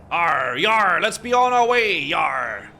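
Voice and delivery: over-the-top pirate voice with yarrs; pirate talk. Pretend. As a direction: ham up a cartoon pirate. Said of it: "Why are you talking like that? Isn't that how pirates talk?".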